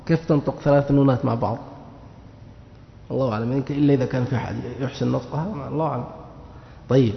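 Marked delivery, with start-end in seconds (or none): none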